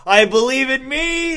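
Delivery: high, loud, drawn-out self-worship; mock proclamation. Host imitating Thor as his own believer, mock-fervent. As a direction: proclaim it like a self-adoring god.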